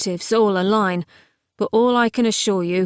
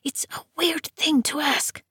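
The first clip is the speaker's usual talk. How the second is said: sharp unvoiced whisper; hissed whisper; 7 semitones higher than usual. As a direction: hiss it in a whisper. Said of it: "her necromancer hissed".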